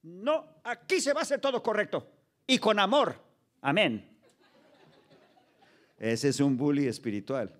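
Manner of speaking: usual speaking voice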